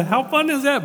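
very high, loud delighted exclaim; loud mock-delighted exclamation. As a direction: burst out with delight. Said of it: "And I thought".

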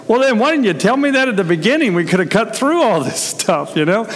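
high, quick exasperated complaint; mock exasperation. Mother and child after belly button talk, exasperated.

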